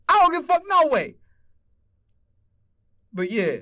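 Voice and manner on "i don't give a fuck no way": heated repeat. Real, not acted. Repeats it even more heated.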